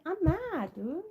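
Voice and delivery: high mock-grumpy pet voice; voicing the pet. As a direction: give the pet a grumpy little voice. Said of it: "She said".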